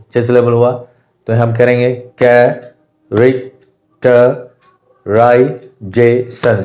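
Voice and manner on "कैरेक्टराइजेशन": कैरेक्टराइजेशन very slowly, six detached syllables; separated syllables. Shown as a demonstration. Teacher demonstrating six beats of the word, separated syllables. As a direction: count out कै, रेक, ट, राइ, जे, शन with clear pauses. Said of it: "छः बार हुआ".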